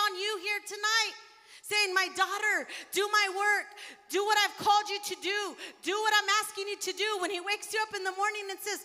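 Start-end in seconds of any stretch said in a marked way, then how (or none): 1.9-7.2 higher, louder shouted command; voicing God's command. Preacher voicing God commanding his daughter, shouting.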